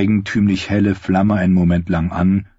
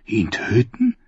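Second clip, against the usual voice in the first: horrified; 3 semitones higher, slower than usual. A narrator's reading. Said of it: "fragte Sam entsetzt".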